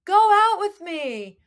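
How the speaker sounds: high, loud, drawn-out call; yelling across a field. Retold or imitated. Host acts Gibsie calling to Claire across the field, yelling. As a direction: holler it slowly as a love-struck plea. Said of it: "yelling across the field to her".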